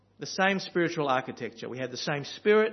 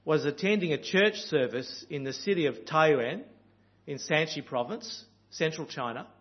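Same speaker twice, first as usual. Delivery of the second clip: normal talking voice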